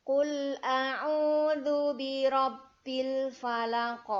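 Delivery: slow, loud melodic chanting; Quran recitation. Shown as a demonstration. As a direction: chant it slowly like murottal. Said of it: "Kita ulang dari ayat pertama".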